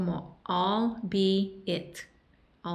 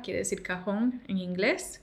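normal talking voice

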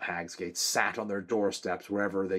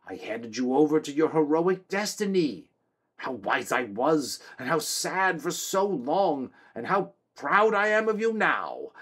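put-on old Drin, overjoyed; 9 semitones higher, 7 dB louder than usual. A narrator's reading. Old Drin fawns over his son the king, high and quavering. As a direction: gush over your son like a doting old wreck.

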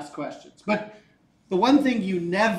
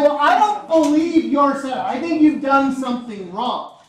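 loud, raised-pitch challenge; acted objection; 6 semitones higher, 9 dB louder than usual. Poisson disputing a rival scientist's experiment, loud acted objection. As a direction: challenge a rival's work loudly and emphatically.

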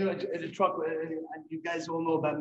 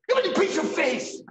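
high, loud mock-gruff tease with a laugh; teasing surprise; 13 semitones higher, 10 dB louder than usual. [name] sneaks up behind Louis and teases him, high and loud. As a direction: catch him out with a laughing jab. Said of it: "it scared me like I was caught".

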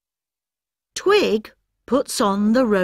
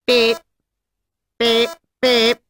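beeping robot; 2 semitones higher, 3 dB louder than usual. Acted. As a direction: beep like a toy robot. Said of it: "says the robot".

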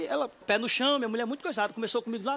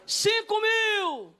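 high, stretched mock auction call; acted long auctioneer yell; drawn-out bid shout; 11 semitones higher, 3 dB louder, much slower than usual. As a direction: holler it slowly across the hall.

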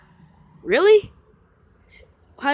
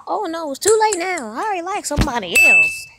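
higher, mock girly letdown; imagined girl's reply; 7 semitones higher, 3 dB louder than usual. Boy imagining the girl's rejection, lightly voiced. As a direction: voice her turning him down lightly. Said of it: "she's already like".

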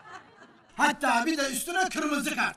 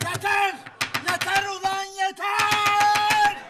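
high loud angry yell; furious shout; 9 semitones higher, 3 dB louder, much slower than usual. Acted. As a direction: roar for order. Judge loses temper.

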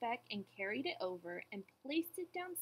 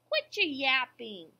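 loud, slow snappish order; calling back; 3 semitones higher, 9 dB louder than usual. A narrator's reading. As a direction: throw it loudly over your shoulder. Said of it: "over his shoulder he called back".

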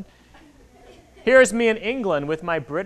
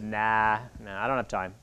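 low, soft, offhand refusal; dismissive brush-off; 9 semitones lower, 7 dB quieter than usual. Retold or imitated. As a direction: wave the idea away quietly. Father brushing off son's suggestion.

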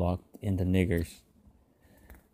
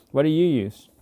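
neighbour's voice; 8 semitones higher, 5 dB louder than usual. A narrator's reading. Neighbour asks about guns.

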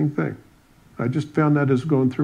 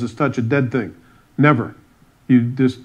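usual speaking voice

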